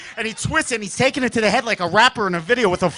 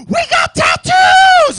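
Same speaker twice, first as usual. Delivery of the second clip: high, slow, loud thrilled yell; ecstatic shout; 12 dB louder, much slower than usual. Retold or imitated. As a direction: scream it like winning the lottery. Thrilled prize discovery.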